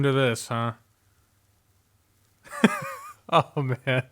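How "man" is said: ordinary talk, nothing marked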